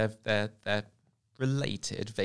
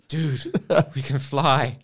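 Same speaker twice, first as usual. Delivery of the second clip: laughing through the words, slowly; can barely get it out for laughing; slow, giggling bee impression; 4 semitones higher, 8 dB louder, slower than usual. Real, not acted.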